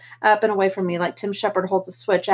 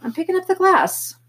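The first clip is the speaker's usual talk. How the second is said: innocent; 10 semitones higher than usual. Acted. Innocent puzzled answer.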